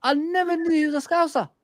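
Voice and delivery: very high, incredulous squeal of surprise; shocked disbelief. Real, not acted. Fan learning Ron Atkinson is a Scouser, shocked disbelief. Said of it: "It shocked me when I heard that".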